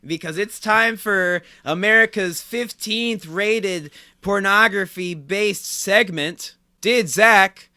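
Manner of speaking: grand game-show intro, slowing into the title; hyped segment announcer. Pretend. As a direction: announce it big, then stretch out the segment name.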